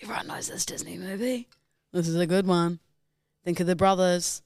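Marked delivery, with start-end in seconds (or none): 0.0-1.5 urgent whisper. Real, not acted. Urging a friend who is stuck on the guess, whispered. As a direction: hiss the hint under your breath.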